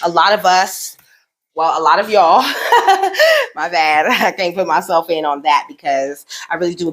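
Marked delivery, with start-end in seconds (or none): 2.5-3.5 sudden high burst of laughter; a real high laugh at her own slip; bright burst of real laughter. Real, not acted.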